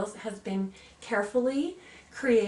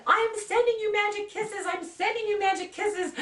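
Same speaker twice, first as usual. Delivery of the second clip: high, raised mock call of a mother; imitating urgent mother; 12 semitones higher, 4 dB louder than usual. Mother to her screaming five-year-old, imitated high urgent reassurance. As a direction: call it out high over a child's crying.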